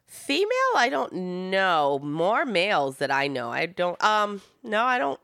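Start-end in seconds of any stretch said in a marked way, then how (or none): none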